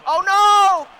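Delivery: loud, high, drawn-out cry; sudden horrified shout; panicked yell. Real, not acted. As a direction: yell in real alarm at the shot. Alarm at backhand shot.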